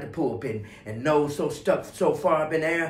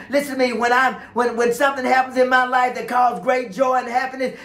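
high, sustained preacher's plea; fervent preaching; 8 semitones higher, 4 dB louder than usual. Real, not acted. Preacher urging people not to envy, fervent preaching. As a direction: press the point home, pitched well up.

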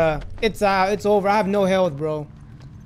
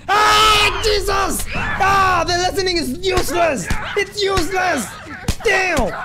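panicked screaming; 7 semitones higher, slower than usual. Real, not acted. Attacked by infected, screaming.

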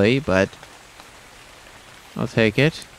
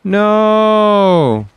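long, high drawn-out cry of dismay; dismayed wail; 7 semitones higher, 4 dB louder than usual. Real, not acted. Gamer watching their guy about to die, dismayed wail. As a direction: wail it out as disaster unfolds.

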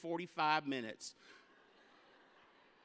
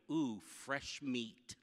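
low, slow, soft leer of "Ooh, fresh meat"; hungry crowd; 6 semitones lower, 5 dB quieter, much slower than usual. Retold or imitated. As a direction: savour it slowly like a predator eyeing prey. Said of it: "looked at me and went".

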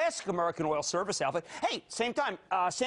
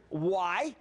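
ordinary delivery

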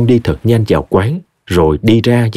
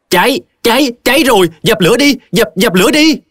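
high-pitched alarmed cry of fire; sudden screaming; 13 semitones higher than usual. Acted. Mad girl warns the tea stall of fire, sudden screaming. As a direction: cry out in urgent bursts, pitched way up. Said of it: "Bất chợt cô hét lên".

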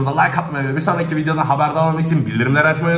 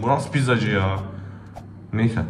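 ordinary delivery

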